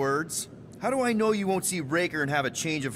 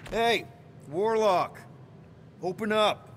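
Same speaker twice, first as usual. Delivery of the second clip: raised voice, demanding entry; calling out; 4 semitones higher than usual. Acted. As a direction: call out sharply to get someone inside to answer. Calling Warlock through a closed door.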